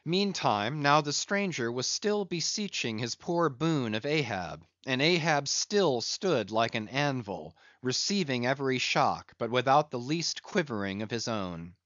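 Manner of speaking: ordinary delivery, nothing marked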